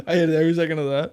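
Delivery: laughing through the words; real laughter breaking the line. Real, not acted. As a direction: giggle your way through it. Laughing at joke.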